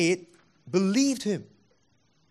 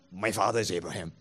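lower, softer smug My father is Abraham; mock stock excuse; 8 semitones lower, 4 dB quieter, faster than usual. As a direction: toss off the excuse as if it ends the matter. Mocking their stock excuse.

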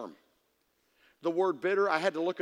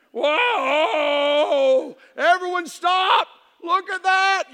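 loud, higher wail at a stubbed toe; hammed-up drama, as if the world ended; mock overreaction; 6 semitones higher, 6 dB louder, much slower than usual.

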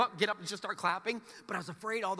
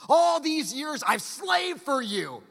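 put-on resentful older son; 7 semitones higher, 6 dB louder, much slower than usual. Angry older son voiced.

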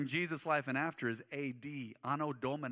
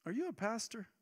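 retold stranger; 8 semitones higher than usual.

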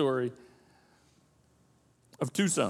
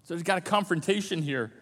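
ordinary talk, nothing marked

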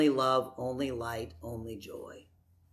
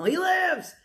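mock shout; 10 semitones higher than usual. Shown as a demonstration. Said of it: "wild and crazy about that".

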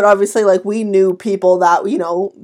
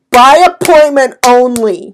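loud, slow, every word stressed; exasperated sign-reading; 3 semitones higher, 7 dB louder, slower than usual. Retold or imitated. Tanning salon worker quoting the door sign, exasperated emphasis. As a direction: hammer out APPOINTMENT ONLY slowly and loudly.

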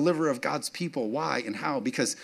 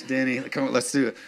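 ordinary talk

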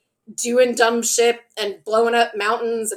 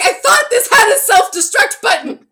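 high, loud, laughing mock outburst; exasperated queen; 10 semitones higher, 7 dB louder than usual. Mimics exasperated queen pressing the button.

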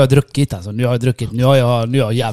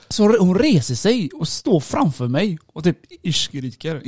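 ordinary talk, nothing marked

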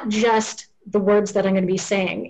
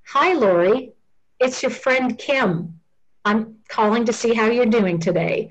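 measured hello to someone who may be confused; slowed-down phone greeting; 3 semitones higher than usual. Shown as a demonstration. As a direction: introduce yourself gently and unhurriedly. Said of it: "slow things down just a little bit".

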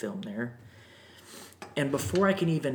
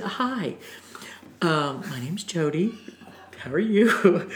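sheepish greeting; 5 semitones higher, slower than usual. Retold or imitated. Awkward greeting to grandmother.

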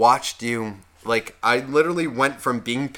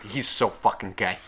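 lower, softer macho put-down; retold deadpan jeer; dismissive guy act; 5 semitones lower, 6 dB quieter than usual. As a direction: drop the voice to cover the emotion. Tough guy front after tender aside.

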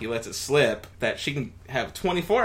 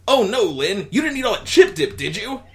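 higher, louder alarmed Alf; 10 semitones higher, 7 dB louder than usual. Acted. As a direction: panicky Alf catching her out. Alf voiced in the story.

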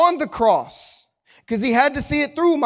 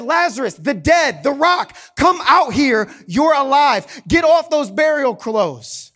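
calling out; 4 semitones higher, 5 dB louder than usual. Acted. Jesus calls Lazarus out.

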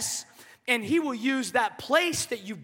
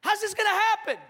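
a pitched-up, slowed Mary asking how; 8 semitones higher than usual. Retold or imitated. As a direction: let Mary's pitch jump up in shock. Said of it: "She goes".

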